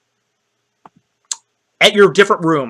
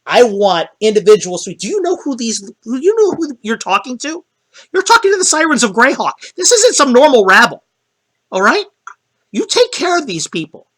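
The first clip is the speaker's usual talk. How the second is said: indignant high manager; 7 semitones higher than usual. Acted. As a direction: play the outraged agent, pitched high. Lester rants at front desk.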